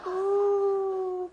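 high, loud, drawn-out "ooh"; wavering ooh. Acted. Possum tempted by snake's plea, high torn ooh. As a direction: let out a long torn moan.

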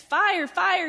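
high, loud false alarm; alarm cry. Acted. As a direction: shout a warning to scare them off. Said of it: "he cried".

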